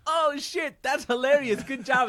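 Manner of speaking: high, loud, delighted; hammed-up cheering; excited praise. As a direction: gush over the joke like an impressed crowd. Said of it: "They'll be like".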